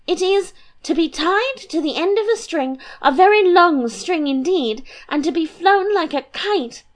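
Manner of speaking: put-on high, bubbly girlish voice; a light, giddy young princess. Princess teasing her papa and mamma, high and bubbly.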